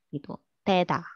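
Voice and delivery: usual speaking voice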